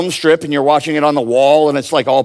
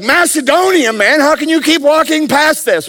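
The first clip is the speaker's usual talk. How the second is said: high, pushy mock plea; urgent pleading guy; 12 semitones higher than usual. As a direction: call out to Paul, exasperated, pitched way up. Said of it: "he's like".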